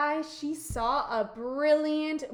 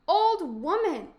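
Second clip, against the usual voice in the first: high, loud call to someone; storybook boy hailing an old woman; 6 semitones higher, 6 dB louder than usual. A narrator's reading. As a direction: call across to her. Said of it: "called a boy who was leading the camel".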